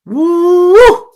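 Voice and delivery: long, high, loud whoop; overwhelmed "woo". Real, not acted. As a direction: let out a rising, drawn-out woo. Overwhelmed exclamation after heated point.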